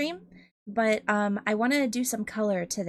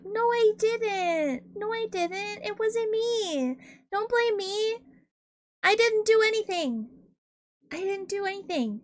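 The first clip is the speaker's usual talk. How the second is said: put-on whiny kid denial; 10 semitones higher than usual. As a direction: plead innocence like a caught child. Chat accused, voicing childish denial.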